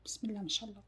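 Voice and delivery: softly whispered mashallah; whispered blessing. Real, not acted. Grandma whispering a blessing over the baking, hushed. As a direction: breathe the blessing under your breath.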